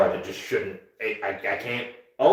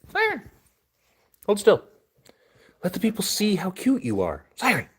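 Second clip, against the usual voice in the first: raised, louder pet voice; high pet talk; 9 semitones higher, 4 dB louder than usual. Real, not acted. Owner holding the squirming dog, high pet talk. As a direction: hold the dog and sweet-talk her up high.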